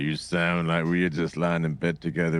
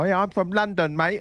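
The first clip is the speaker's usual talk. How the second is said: higher, hammed-up Cockney London voice; mock London accent; 13 semitones higher than usual. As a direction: mimic a poser's London accent. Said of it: "people were like".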